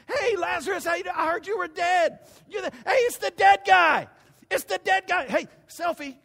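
high, loud fan shouting; hammed-up excited fans; starstruck crowd. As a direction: mob him like a celebrity. Crowd mobbing a celebrity.

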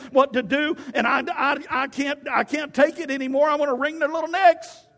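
high whining tirade, building to a peak; mock exasperated rant. Believer griping about spouse and kids, mock exasperated whine. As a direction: pile up grievances in hammed-up despair.

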